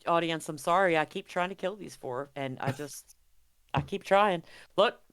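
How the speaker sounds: ordinary delivery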